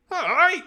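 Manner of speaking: high, very loud yell; piercing imitation of an angry shout; second angry cry. Shown as a demonstration. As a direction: fire off another yelled cry. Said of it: "The other one on the back".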